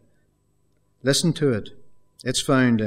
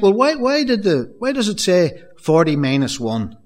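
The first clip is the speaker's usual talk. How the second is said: higher, puzzled questioning; imagined listener; 6 semitones higher, 5 dB louder than usual. Retold or imitated. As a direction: voice a curious listener's doubts. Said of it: "You might think".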